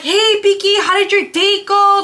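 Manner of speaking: high, loud eager greeting; bubbly sisters asking for gossip; excited. Retold or imitated. Twins greeting her at the door, high and excited. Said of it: "they were so excited to hear about my date".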